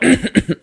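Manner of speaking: theatrical throat clear before going deep; mock throat clear. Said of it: "their voice gets a lot deeper, like".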